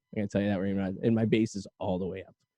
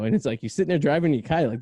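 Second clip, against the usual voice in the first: normal talking voice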